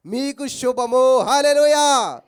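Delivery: slow, loud, drawn-out blessing; Jesus greeting. Acted. Jesus greeting the women, proclaimed slowly and loudly. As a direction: stretch each word out like a proclamation. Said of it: "ఈయన ఎదుర్కొనే ఏమంటున్నాడు".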